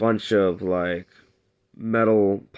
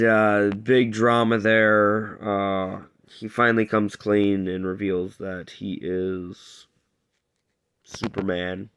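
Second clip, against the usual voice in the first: ordinary talk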